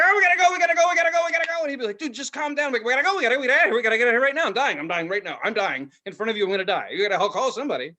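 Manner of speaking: high, loud, frantic mock terror; hammed-up panic. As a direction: beg to leave as if dying.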